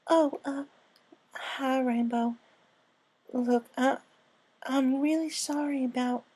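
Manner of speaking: high, halting filly apology; nervous little filly. Acted. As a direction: stammer out a sorry in a small high voice. Apple Bloom apologizing.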